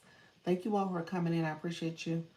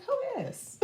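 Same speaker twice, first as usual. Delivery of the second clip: squeaky mock question. Retelling her own reaction, a high mock-puzzled squeak. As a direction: jump way up into a tiny high squeak.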